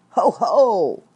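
big monster laughing at trapped animals; heffalump gloating. Acted. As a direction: boom it out like a gloating beast. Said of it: "in the sort of voice this heffalump".

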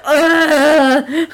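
hammed-up loud, high dying groan; badly faked death cry; fake death groan. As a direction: groan like a terrible actor dying. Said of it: "like, a terrible fake death scene".

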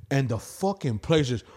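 ordinary talk

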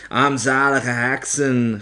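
loud, drawn-out scornful yell; witch yelling abuse. A narrator's reading. Opperheks scolding her nervous audience, yelling. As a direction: hurl the insult slowly at the crowd. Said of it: "gilde ze".

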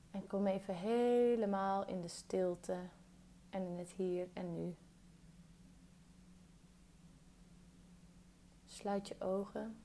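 calm meditation guide, long pauses between phrases; guided meditation. Real, not acted. Guiding a listener through a body scan, calm meditation voice.

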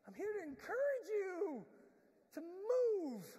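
high, loud, drawn-out cheer; encouraging call. Pretend. Goose honk as encouragement.

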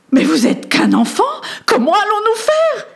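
high, dismayed noblewoman's cry; a lady shocked to find only a child; exclaiming lady. A narrator's reading. Lady sees the boy sent to save her, high and dismayed.

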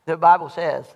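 ordinary delivery

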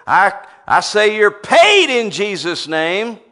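loud, slow, high declaration; declaring aloud. Retold or imitated. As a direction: command the debts to be paid. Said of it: "I'd say".

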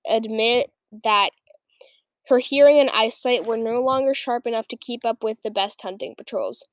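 ordinary delivery

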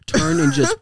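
ordinary talk

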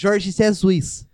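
put-on loud, very drawn-out Brazilian accent; mock Brazilian accent. Joining in the mockery of David, hammed-up Brazilian accent. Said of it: "quando foi ao Brasil".

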